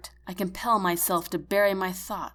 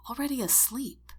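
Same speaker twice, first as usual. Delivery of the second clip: ordinary delivery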